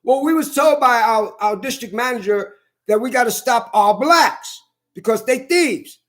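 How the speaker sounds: ordinary talk, nothing marked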